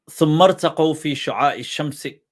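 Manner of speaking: fast, rhythmic Arabic verse recital; poetry recitation. Shown as a demonstration. Reciting the poet's praise verse to his audience, rhythmic and fast. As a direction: declaim the verse quickly.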